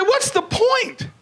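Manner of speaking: high, slow, disbelieving question; mock incredulous. Comedian mocking blind cousin's skydiving plan, high and slow. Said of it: "I said".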